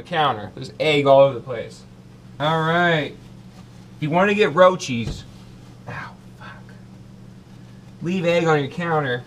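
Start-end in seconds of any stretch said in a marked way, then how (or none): none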